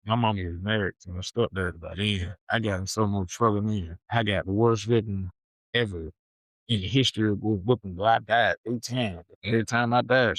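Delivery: ordinary talk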